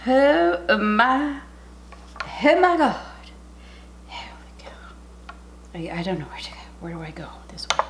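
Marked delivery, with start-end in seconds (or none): 0.1-3.0 high, loud amazement; thrilled delighted exclaim; amazed. Real, not acted. Tasting, amazed. 4.1-7.3 hushed whisper at the food. Real, not acted.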